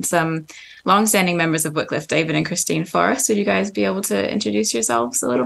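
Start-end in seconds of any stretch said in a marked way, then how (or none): none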